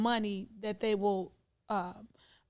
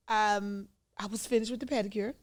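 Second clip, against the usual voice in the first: ordinary talk, nothing marked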